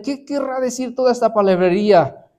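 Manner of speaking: high, loud mocking question; scoffing. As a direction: scoff at the babbler. Scoffing Athenians in scripture.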